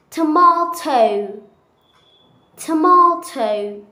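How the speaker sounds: tomato as to-mah-to, slow and loud; British accent. Shown as a demonstration. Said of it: "a little bit different".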